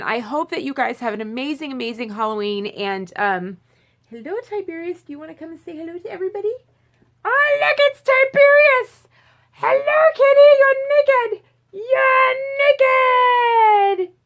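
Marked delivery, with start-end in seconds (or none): 4.1-6.5 higher, soft coaxing to a pet; talking to the cat. Real, not acted. Greeting her cat on camera. 7.2-14.1 very high, loud pet squeal; excited squeal at cat. Real, not acted. Squealing over her cat.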